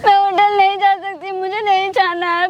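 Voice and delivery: sobbing out the refusal; crying refusal. Acted. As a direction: refuse through real tears, voice breaking. Girl cries and refuses. (मालिक से रोते हुए इनकार)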